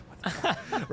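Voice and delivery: high, startled disbelief; a sudden high what-the-fuck; shocked outburst. Retold or imitated. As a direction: blurt it out in stunned disbelief. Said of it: "I'm like".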